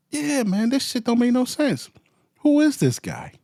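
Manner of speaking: high, disbelieving reaction; can't believe what he's reading; incredulous. Real, not acted. Reacting to a news story for viewers, incredulous.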